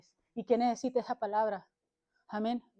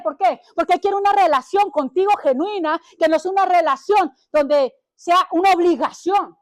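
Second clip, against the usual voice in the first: plain; 6 semitones higher, 11 dB louder than usual. Acted. Preaching at full force.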